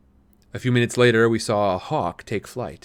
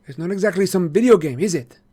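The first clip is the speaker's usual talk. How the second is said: lightly voiced sly boy; 9 semitones higher, 6 dB louder than usual. A narrator's reading. Marco voiced in story.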